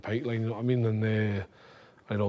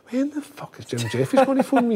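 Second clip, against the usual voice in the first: higher, louder, laughing; laughing impatience; 12 semitones higher, 4 dB louder than usual. Retold or imitated. Waiting for Jim's call, laughing impatience. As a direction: blurt it through a laugh.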